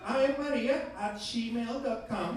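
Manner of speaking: mock Argentine English. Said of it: "client's email".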